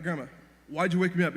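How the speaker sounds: a loud, grouchy yell at grandma; half-real angry yell from bed. Retold or imitated. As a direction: shout it, just woken too early. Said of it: "I started yelling".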